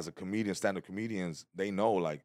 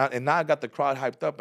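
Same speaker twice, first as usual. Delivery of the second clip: usual speaking voice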